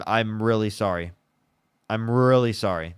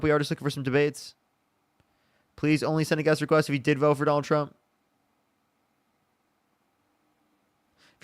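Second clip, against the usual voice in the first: announcer voice; 5 semitones higher, 4 dB louder than usual. Acted. Stream host plugging channel.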